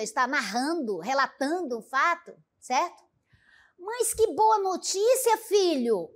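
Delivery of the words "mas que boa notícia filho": a mother's delighted exclamation, pitched high on que; happy mother. Acted. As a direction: read it as a joyful mother hearing good news. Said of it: "a mãe também ficou alegre".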